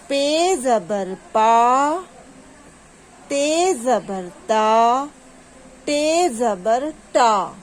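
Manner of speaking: slow spelling chant, long held final vowel; drawn-out reading drill. Shown as a demonstration. Teacher restarting the zabar drill for pupils, drawn out. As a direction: lead a repeat-after-me drill, stretching each syllable. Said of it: "اسی طرح سے آپ لوگوں کو پڑھنا ہے".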